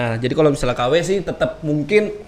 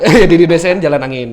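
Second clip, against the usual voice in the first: laughing, louder; 3 semitones higher, 9 dB louder than usual. Real, not acted. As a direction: laugh through the words. Laughing at the translated name.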